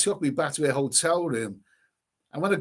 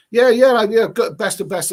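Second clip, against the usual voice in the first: loud mock enthusiasm; sarcastic cheer; 6 semitones higher, 10 dB louder than usual. Real, not acted. Radio host mocking the migrants' treatment, loud sarcastic cheer.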